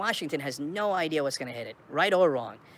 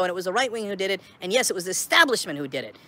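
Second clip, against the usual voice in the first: ordinary talk, nothing marked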